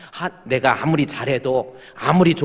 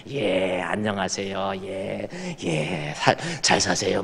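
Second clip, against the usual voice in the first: lower, drawn-out unctuous politeness; a fake-gentle elder greeting the flock; oily greeting; 6 semitones lower, 5 dB quieter, slower than usual. Elder greeting churchgoers, mock unctuous and low. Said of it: "막 이러고 있는데".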